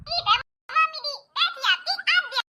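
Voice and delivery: high-pitched lisping daughter-in-law; childlike t-for-k lisp, pitched high. Acted. Daughter-in-law politely seating guests for mother-in-law, lisping. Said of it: "बहू सच में तोतली है".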